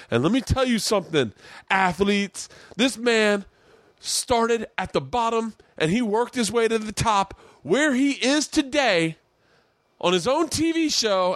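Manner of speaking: a hammed-up rousing locker-room coach; inspirational coach speech.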